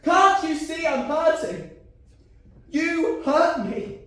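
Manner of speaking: high, loud, slowing cries; yelling his pain at his ex; hurt shout. Acted. Pained shouting in an argument.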